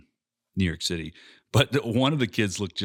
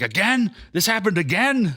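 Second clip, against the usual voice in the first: high-pitched incredulous scolding; exasperated scolding; 10 semitones higher, 3 dB louder than usual. Retold or imitated. Mom smacking kids getting into the car, high exasperated scolding. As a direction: imitate a fed-up mom.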